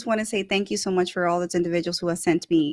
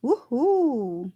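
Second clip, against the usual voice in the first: high, swooping woohoo; cheering on a supporter; celebratory cheer; 4 semitones higher than usual. Real, not acted. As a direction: let out a happy, higher-pitched whoop. Host cheers after sponsor plug.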